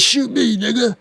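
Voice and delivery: high-pitched mocking impression, daring and loud at the end; mock taunting man. Imitating the man who got shot daring the shooter, high mocking taunt. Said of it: "the guy that says".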